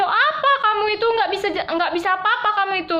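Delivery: high, sharp telling-off; mock scolding boss. Foundation boss berating the new maid, high and sharp. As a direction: scold her like a harsh boss.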